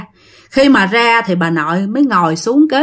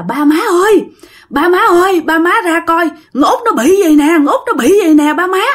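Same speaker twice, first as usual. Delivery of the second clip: higher-pitched, urgent cries for the parents; alarmed calling out; 6 semitones higher than usual. Retold or imitated. Father runs in calling his parents, alarmed. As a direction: call for help in a retold panic. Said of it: "Ba của bạn mới chạy vô mới kêu".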